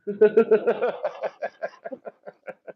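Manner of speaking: laughing. Real, not acted. Host laughs after ranting about refs, loud laughter. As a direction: burst of real laughter.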